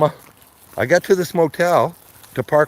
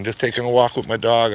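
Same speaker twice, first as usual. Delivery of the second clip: ordinary talk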